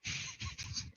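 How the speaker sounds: high whispered manic cackle; a thin, breathy battle laugh. Acted. Villainous fighter laughing.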